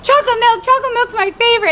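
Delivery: high-pitched delighted exclamation; retold excitement. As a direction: re-enact giddy delight at a treat. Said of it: "I was just so excited".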